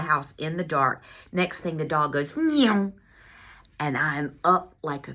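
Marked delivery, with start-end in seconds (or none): 2.4-2.9 imitated animal noise. Dog makes noise.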